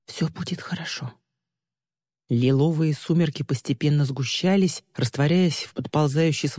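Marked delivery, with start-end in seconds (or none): 0.1-1.1 soft whispered reassurance. A narrator's reading. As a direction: whisper it close, to comfort him. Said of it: "Пэк прижалась к нему и прошептала". (успокаивающий шёпот)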